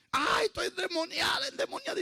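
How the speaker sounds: high-pitched mock shriek of a frightened girl; imitated terrified scream. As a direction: cry out in panic, voice way up high. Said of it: "y gritaba la joven espantada".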